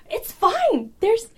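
high, loud, cheerful "no problem"; breezy naive reassurance. Retold or imitated. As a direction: brush it off brightly, totally unbothered. Naive reassurance to the angry driver.

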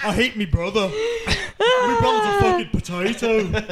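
high laughing Mancunian brother rant; mock Manchester whinge. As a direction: play the feuding brother, cracking up. Mock [name] brothers bickering.

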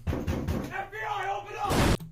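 high, slow then shouted; mock police shout. Mock FBI raid yelled at the door. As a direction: bellow it like a raid. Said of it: "pulling a Vidrin".

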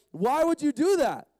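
high, loud indignant question; indignant protest. Acted. Blind man to whoever muddied his eyes, indignant. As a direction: pitch climbing in mock outrage.

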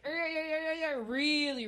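high-pitched agitated jabbering; mock a worked-up guy ranting; imitated agitated babble; agitated yammering. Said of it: "really agitated".

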